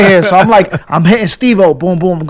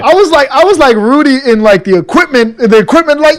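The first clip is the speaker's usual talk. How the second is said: high, loud giddy shout; bursting with disbelief; giddy excitement; 8 semitones higher, 6 dB louder than usual. Retold or imitated. Sitting on a fold-out chair, he shouts high with joy. Overjoyed at Def Jam.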